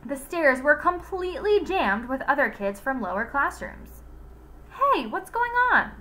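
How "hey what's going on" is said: much higher, louder call; shouting. Acted. Joy calling over the crowded stairwell, shouting. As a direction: yell it up the jammed stairs. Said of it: "shouted Joy".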